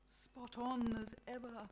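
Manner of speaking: slow, mildly sad God; reply with a touch of sorrow, slowly. A narrator's reading. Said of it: "God says sadly".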